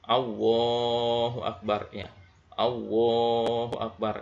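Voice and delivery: "Allahu Akbar" said slowly as "Aohu Akbar", with no L at all; both lams to waw. Shown as a demonstration. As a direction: drop both L sounds of "Allahu" into a W. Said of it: "langsung waw semuanya".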